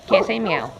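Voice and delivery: ordinary talk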